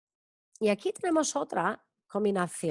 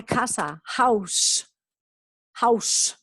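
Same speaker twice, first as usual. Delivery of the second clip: ordinary talk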